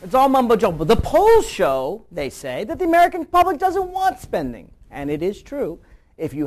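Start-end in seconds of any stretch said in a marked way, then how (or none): 0.0-0.8 mocking echo. Host scoffing at the pundit's mumbo jumbo jibe, mocking. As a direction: throw the jibe back, louder and higher.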